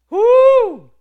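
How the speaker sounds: high, very loud woo; exultant whoop. Real, not acted. Preacher exulting mid-psalm, exultant whoop. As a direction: let out a piercing whoop of praise.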